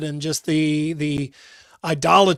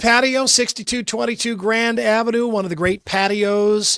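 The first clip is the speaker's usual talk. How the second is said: raised, high-energy sponsor read; radio ad read; 5 semitones higher, 4 dB louder than usual. Acted. Radio host plugging a sponsor's patio, upbeat ad read. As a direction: sell it brightly at a higher pitch.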